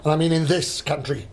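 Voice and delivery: normal talking voice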